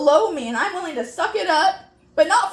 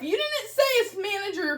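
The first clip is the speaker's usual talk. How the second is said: ordinary talk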